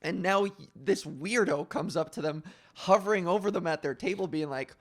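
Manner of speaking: normal talking voice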